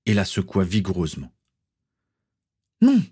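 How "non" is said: sharp cry of no; cried out. Acted. Tatiana over the falling Swazik, crying out. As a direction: cry out in alarm. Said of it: "s'écria-t-elle".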